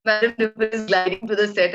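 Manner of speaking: amused imitation of a dutiful walkie call; imitating reluctant assistant. Poor assistant announcing star on intercom, imitated compliance. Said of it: "that poor girl had to say".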